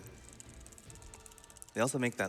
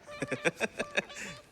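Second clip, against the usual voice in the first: high giggle. Real, not acted. Laughing at own remark.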